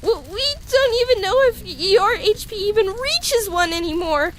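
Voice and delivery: high, stammering fretting; panicked worry. Acted. Worrying over a frail patient, panicked. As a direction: let the worry spiral upward.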